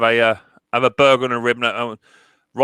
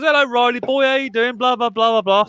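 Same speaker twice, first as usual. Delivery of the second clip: much higher, sing-song mock greeting; imitating Taff; 10 semitones higher than usual. Taff greeting Riley at the door, mimicked much higher. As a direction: chirp it like an over-friendly mate at the door. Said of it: "He goes".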